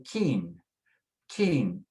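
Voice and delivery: keen with a K sound and no U; silent U. Shown as a demonstration. Teacher shows the U is silent, plain K sound. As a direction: say keen as one long-ee syllable. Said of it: "the U is silent".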